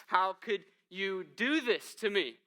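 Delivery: slow, loud, accusing cry at God; angry protest. Acted. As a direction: demand it of God, hurt and indignant. Jonah protesting God, acted quote.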